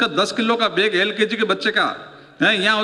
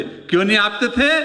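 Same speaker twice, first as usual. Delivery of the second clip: ordinary talk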